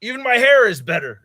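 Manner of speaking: loud, high cocky comeback; a loud, boastful mock brag; boastful shout. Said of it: "And I was like".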